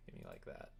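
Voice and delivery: usual speaking voice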